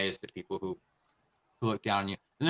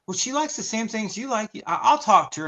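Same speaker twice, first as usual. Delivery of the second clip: lightly mimicking a chirpy friend, higher; high imitated friend voice; 12 semitones higher, 4 dB louder than usual. Imitating his friend Susan promising to talk to Paige, high voice. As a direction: quote the friend in a high put-on voice. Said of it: "And she's like".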